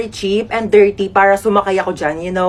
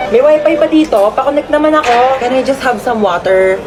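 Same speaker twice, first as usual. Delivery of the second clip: ordinary delivery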